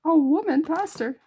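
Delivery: imitating shocked churchgoers, pitch jumps up; shocked gasp. Baptists gasping at a woman pastor, mock shocked. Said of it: "all you Baptists are gasping".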